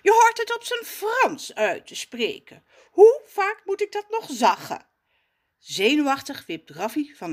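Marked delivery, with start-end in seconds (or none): 0.0-4.9 higher, louder snooty correction; posh voice. Acted. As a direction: correct the name haughtily. Jacques lecturing.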